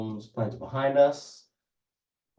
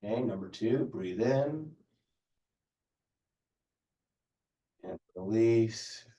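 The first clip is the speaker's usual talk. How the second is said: calm, even yoga teacher cues; yoga instruction. Acted. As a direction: guide the class through breaths unhurriedly. Leads a stretching class.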